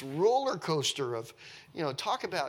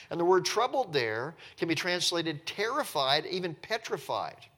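normal talking voice

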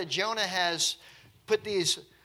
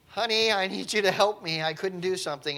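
mock plea, higher and louder at first; retold plea for help; 4 semitones higher, 7 dB louder than usual. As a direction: call out to a spouse for help, hammed up. Retold plea for help after injury.

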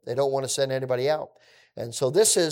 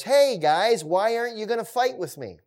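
Goliath taunting Israel, a hammed-up jeer; taunting giant; 6 semitones higher than usual. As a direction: goad the army from across the valley. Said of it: "this enemy, says".

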